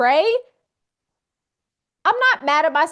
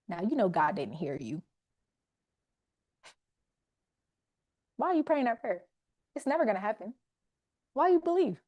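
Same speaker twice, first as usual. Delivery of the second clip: softer, lower taunting voice of doubt; the enemy's voice; 3 semitones lower, 9 dB quieter, faster than usual. Retold or imitated. Preacher voices the enemy whispering doubt, soft low taunting. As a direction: hush it like a sneering tempter. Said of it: "so when the enemy says something".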